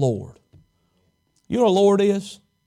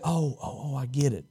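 much softer, lower oh I get it; abashed realization; 6 semitones lower, 11 dB quieter than usual. Retold or imitated.

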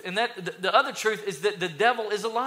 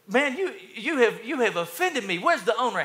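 offended; 5 semitones higher than usual. Acted. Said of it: "The man is offended".

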